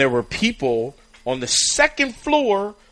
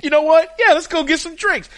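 excited; 12 semitones higher, 5 dB louder than usual. Retold or imitated. Mikey answers fans' invitation.

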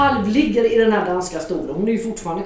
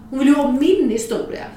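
ordinary delivery

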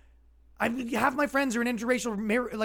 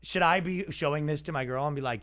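ordinary delivery

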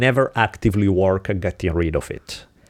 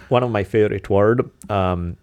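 ordinary delivery

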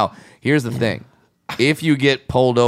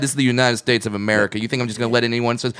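ordinary talk, nothing marked

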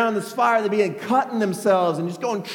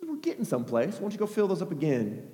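soft, offhand order; quietly satisfied, quick request; understated approval; 8 dB quieter, faster than usual. Acted. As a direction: murmur it as a deadpan aside. Elijah coolly ordering more water.

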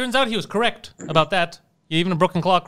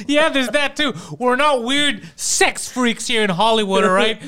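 mock celebrity; 5 semitones higher, 4 dB louder, slower than usual.

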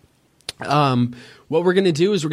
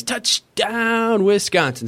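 higher, stretched touchdown call in a radio announcer's voice; Matt LePay touchdown call; 7 semitones higher, slower than usual. Retold or imitated. Imitating the Wisconsin radio man's touchdown call, announcer voice. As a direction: ring out the touchdown like a stadium radio man. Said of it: "And the great Matt LePay for Wisconsin says".

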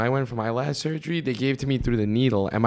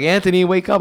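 louder, higher imitation of waking someone; rousing call; 7 semitones higher, 5 dB louder than usual. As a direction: call out to rouse a sedated patient. Quoting staff rousing a patient.

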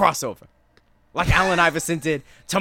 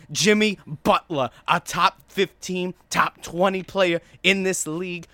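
Stephen A Smith impression. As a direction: hammer it like a hot-take sports shouter. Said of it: "I was gonna go Stephen A on this".

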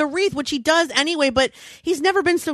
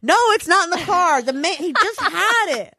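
exasperated retort; 5 semitones higher than usual. Retold or imitated. Wife answering husband's stupid questions, exasperated. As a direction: snap back in disbelief at a silly question. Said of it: "Scott's asking me stupid questions".

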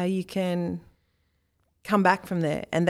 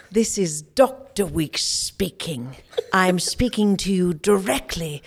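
slow, plummy cultivated Australian voice; deliberate cultivated Australian diction; posh Australian accent; 4 dB louder, slower than usual. Retold or imitated. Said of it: "hang on, one more time".

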